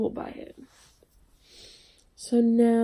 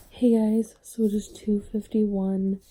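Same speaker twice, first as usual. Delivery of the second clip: usual speaking voice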